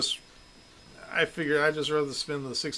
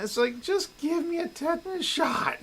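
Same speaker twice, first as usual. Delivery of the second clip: exasperated. Retold or imitated. Exasperated retort, high pitched.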